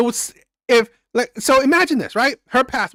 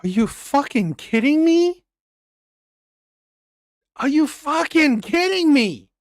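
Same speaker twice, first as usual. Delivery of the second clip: incredulous outrage; 5 semitones higher, slower than usual. Real, not acted. Host outraged at the story.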